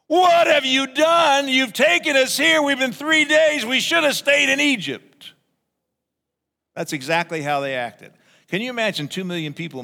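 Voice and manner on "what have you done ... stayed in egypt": loud complaining. Retold or imitated. Israelites shouting complaints at Moses in the desert, high loud whine. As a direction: wail it like a crowd fed up after three thirsty days. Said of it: "to complain all night long loudly".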